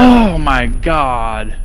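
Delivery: long stretched-out exclamation; shocked. Real, not acted. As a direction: drag it out in shock. Reacting to explosion.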